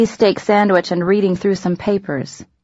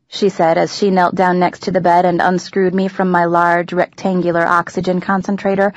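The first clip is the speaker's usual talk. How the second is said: ordinary delivery, nothing marked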